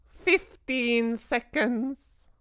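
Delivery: high, slow witch voice; a gloating witch counting down; witch's cry. A narrator's reading. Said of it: "cried the Grand High Witch".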